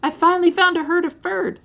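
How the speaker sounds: high, loud joyful cry; gleeful yell. Acted. Ferd crying out in glee at finding the herd. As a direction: burst out with delight. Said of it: "yelling with glee".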